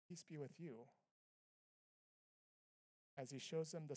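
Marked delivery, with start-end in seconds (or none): 0.1-0.9 softer, calm and steady; quiet peace. Shown as a demonstration. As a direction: hush it, let it rest. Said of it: "No, it's".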